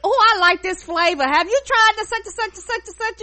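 high, nosy cashier chatter; chatty cashier voice. Retold or imitated. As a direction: play a nosy grocery clerk. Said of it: "She be like".

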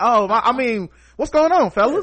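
high, sheepish, suddenly nice; meek backpedal. Retold or imitated. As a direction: fold instantly and act friendly. Backs down meekly.

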